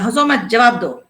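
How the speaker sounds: sharp, loud command; angry. A narrator's reading. Matadeen silencing the laughing thugs, angry. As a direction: cut their laughter dead. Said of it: "माता दीन को गुस्सा आ गया".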